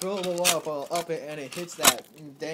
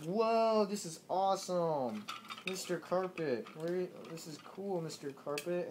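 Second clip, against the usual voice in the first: mock amazement; 3 semitones higher than usual. Man riding a flying carpet over the desert, amazed. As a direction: exclaim it in hammed-up wonder.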